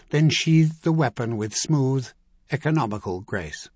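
usual speaking voice

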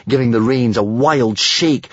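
plain reading voice

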